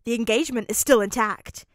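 loud, triumphant crow. A narrator's reading. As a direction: announce it loudly like you've won. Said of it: "Kirishima said triumphantly".

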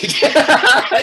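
loud high chuckle; short laugh. Real, not acted. Laughs about forgotten prayer.

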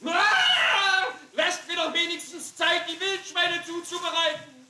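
shout. Acted. Cook protests loudly.

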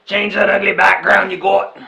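put-on loud, jeering Australian accent; mock Australian accent, shouted. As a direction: yell it like an outraged Aussie. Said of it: "A couple Australians complained".

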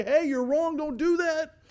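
high, loud alarmed call; parent's warning. Acted. Parent warning a small child at an outlet, loud alarmed call. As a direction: shout to stop a child.

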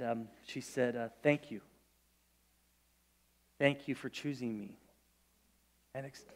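tender, barely voiced recollection; a quiet, moved retelling of her thanks; hushed, moved. Real, not acted. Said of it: "emotional retelling, very soft".

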